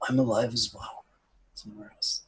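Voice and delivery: soft, fading to very quiet; hushed reply. Acted. Prisoner warned to be quiet, hushed reply. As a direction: answer under your breath. Prisoner answering softly after warning.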